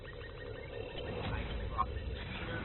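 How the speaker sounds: whispered. Shown as a demonstration. Repeating the line, whispered slowly.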